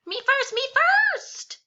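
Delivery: very high loud demand; shouting. Acted. Child shouting in class for a turn, very high. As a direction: shout to go first. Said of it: "I shouted".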